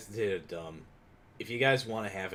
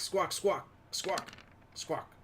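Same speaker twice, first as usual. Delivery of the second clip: squawking to bird; 8 semitones higher, slower than usual. Acted. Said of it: "I'm trying to say, "Attack them".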